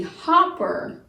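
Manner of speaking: HOPPER with a short O, as in hop. Shown as a demonstration. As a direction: say HOPPER to rhyme with stopper. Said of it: "that this vowel is short".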